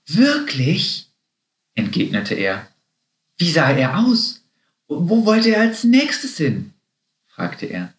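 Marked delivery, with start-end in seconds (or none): 0.1-1.1 high-pitched, delighted question; eager amazement. A narrator's reading. Happy hears of the Easter Bunny, eagerly amazed. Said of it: "Happys Augen funkelten". 3.4-6.7 high, excited questions climbing higher; eager questioning. A narrator's reading. Happy quizzes the rodents about the Easter Bunny, eagerly. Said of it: "Happys Augen funkelten".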